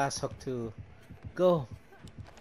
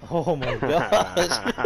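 laughing; 6 dB louder, much slower than usual. Real, not acted. Laughing at enemy's fail.

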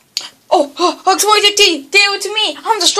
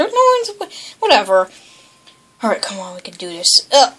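normal talking voice

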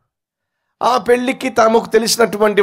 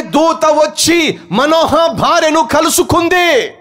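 fervent sermon peak; full-force preaching; 7 semitones higher than usual. Acted. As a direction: proclaim it to the whole church.

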